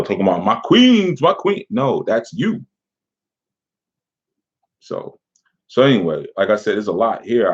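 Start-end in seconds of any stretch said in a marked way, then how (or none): 0.4-1.6 high, mocking sing-song "my queens"; mocking fake royalty. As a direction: ham up a poser greeting his queens. Mocking a fake conscious guy.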